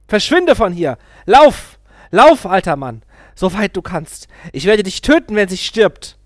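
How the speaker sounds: a man yelling threats down the stairs; furious shouting. A narrator's reading. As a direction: scream the threats after him. Chasing guru with gun.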